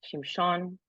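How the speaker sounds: slow, measured poem reading with long pauses. Acted. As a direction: let each image land, unhurried. Reads English translation of poem.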